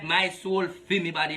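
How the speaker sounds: ordinary delivery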